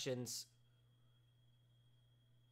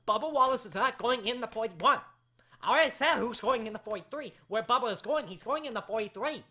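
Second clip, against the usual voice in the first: higher, louder insistent repeat; exasperated, voice going weird; 4 semitones higher, 6 dB louder than usual. Real, not acted. Host repeating where Bubba is going, exasperated. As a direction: push each answer out, fed up. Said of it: "now my voice sounds weird".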